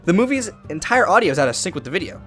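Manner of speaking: normal talking voice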